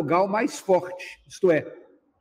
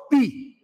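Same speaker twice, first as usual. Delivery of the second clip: Pi said alone, pitched up and punched; stressed syllable pi; 6 semitones higher than usual. Shown as a demonstration. Teacher showing students the tonic syllable, sharply. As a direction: isolate the strong syllable Pi.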